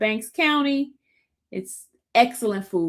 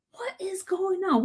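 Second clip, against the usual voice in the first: hushed whisper, breathy and alarmed; 11 semitones higher, 6 dB quieter than usual. Retold or imitated. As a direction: whisper it like you're scared to be overheard in court.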